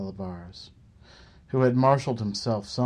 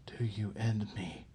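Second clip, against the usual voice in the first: softer, whispered, utterly steady; calm whisper; 11 dB quieter than usual. Acted. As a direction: ask it as calmly as a market price. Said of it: "with rock-solid calm".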